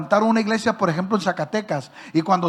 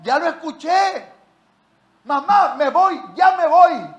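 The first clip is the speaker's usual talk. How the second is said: higher, slow, exasperated pleading; annoyed; 8 semitones higher, 5 dB louder, slower than usual. Retold or imitated. Angry son insisting to his mother he must leave, exasperated and high. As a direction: whine it like an impatient son. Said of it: "Me enojé".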